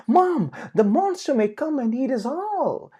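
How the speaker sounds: put-on high, loud child's fear; panicking piglet; scared cry. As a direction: piglet frightened of being eaten. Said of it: "Patty cried".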